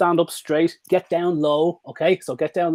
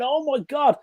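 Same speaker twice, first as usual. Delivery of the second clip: much higher delighted gasp; over-the-top delight; 12 semitones higher, slower than usual. Shown as a demonstration. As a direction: sell the joy to the dog. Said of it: "You make a massive scene".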